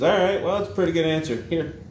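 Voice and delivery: high, loud jovial Saint Peter; a booming cheery gatekeeper. Acted. Saint Peter voiced in joke.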